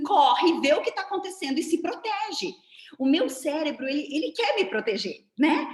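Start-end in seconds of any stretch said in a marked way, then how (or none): 0.0-2.5 loud, high urgent order; high-pitched rush to act now; urgent command. Acted. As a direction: bark an alarm as the panicking brain. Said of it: "meu cérebro vai dizer assim".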